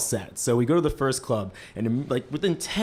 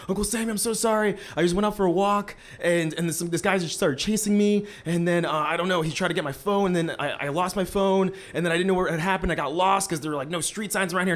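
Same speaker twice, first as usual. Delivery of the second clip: higher, rushed pleading excuse; frantic fake apology; 6 semitones higher than usual. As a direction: spill the lie fast and breathless to dodge trouble. Lying apology to worried uncle.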